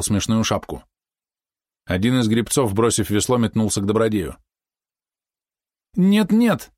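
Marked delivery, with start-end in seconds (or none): 5.9-6.7 high, alarmed protest; hasty objection. A narrator's reading. Helmsman stops them untying the boy, hasty protest. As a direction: jump in anxiously, pitch right up. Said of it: "откликнулся кормчий поспешно".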